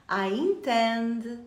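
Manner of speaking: I intend, drawn out slowly; slow repeat-after-me I intend; slow model phrase. Shown as a demonstration. Teacher drilling I intend for students. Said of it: "Já treina comigo".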